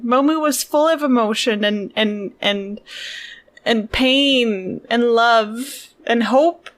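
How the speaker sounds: slow, drawn-out mock sincerity; mock dramatic. Real, not acted. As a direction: stretch every word dramatically.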